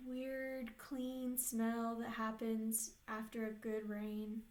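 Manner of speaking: unhurried meditation voice with long pauses; guided meditation. Real, not acted. Therapist leading clients into an inner peaceful place, guided meditation. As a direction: guide them gently, leaving space between phrases.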